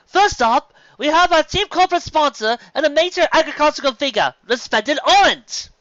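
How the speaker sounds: high, loud booming emcee; announcer voice. A narrator's reading. Master of Ceremonies announcing judges to the crowd, booming. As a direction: call it out like a ceremony host. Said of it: "the Master of Ceremonies was beginning to speak".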